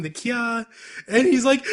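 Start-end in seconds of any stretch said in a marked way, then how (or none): 0.1-0.7 short, higher-pitched imitation of a girly scream; mock girl scream. Retelling an anime boy who freaks out, mock girlish scream. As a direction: playfully imitate a typical girl's scream. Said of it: "He does the typical girl scream".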